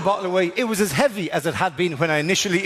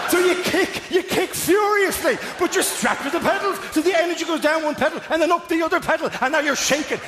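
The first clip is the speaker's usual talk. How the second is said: manic high retelling; 10 semitones higher than usual. Pretend.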